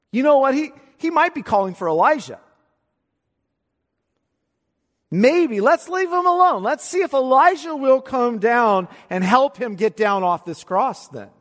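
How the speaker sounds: high, loud jeering taunt; mocking bystander. Bystanders jeering at Jesus on the cross, mocking high and loud. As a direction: taunt him like a scoffer in the crowd. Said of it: "They're mocking".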